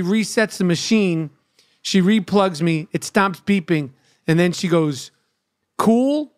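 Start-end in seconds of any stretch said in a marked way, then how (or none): 5.8-6.3 "Cool?" pitched high and rising like a question. Retold or imitated. Nurse checks with her patient, questioning high "Cool?". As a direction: ask "Cool?" with the voice lifting right up. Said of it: "Like she's asking me".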